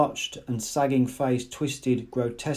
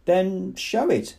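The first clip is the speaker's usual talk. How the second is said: higher, slow challenge; cold demand; 7 semitones higher, slower than usual. Acted. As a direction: dare him to prove it. Director demands proof.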